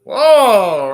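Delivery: surprised whoa. Real, not acted. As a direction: react as if caught off guard. Reacts to bold move onscreen.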